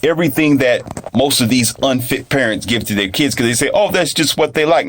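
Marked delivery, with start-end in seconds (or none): 3.7-4.9 higher-pitched dismissive imitation; mock excusing parent. Parents defending kids' junk food, mock excuse. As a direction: mimic a parent making excuses.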